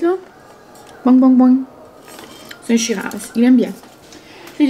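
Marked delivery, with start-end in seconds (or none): 1.0-1.6 loud, playful boing sounds; kangaroo hopping noises. Pretend. Vlogger describes the jumping kangaroo label, playful hopping noises. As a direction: imitate a hopping kangaroo, three bounces. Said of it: "un petit kangourou qui saute".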